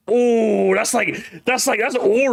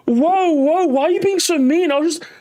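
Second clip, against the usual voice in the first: put-on crybaby protest; whiny cry-bully; 9 semitones higher than usual. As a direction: play the wounded troll acting innocent. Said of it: "the cry bully type of trolling".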